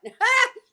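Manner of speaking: high, loud burst of real laughter; bright giggling burst. Real, not acted. Laughing at her own story.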